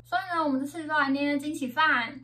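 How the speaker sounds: usual speaking voice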